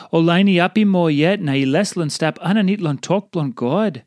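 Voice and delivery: ordinary talk, nothing marked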